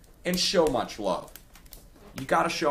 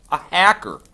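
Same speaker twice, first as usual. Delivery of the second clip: loud, high scoffing repeat; outraged disbelief; 7 semitones higher, 12 dB louder than usual. Real, not acted. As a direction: throw the word back in outrage. Outraged at accusation of hacking.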